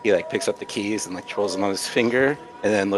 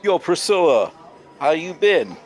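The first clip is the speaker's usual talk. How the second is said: higher, louder playboy greeting; a swaggering hello to impress her; cocky smooth talker; 8 semitones higher, 5 dB louder than usual. Acted. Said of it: "he's like".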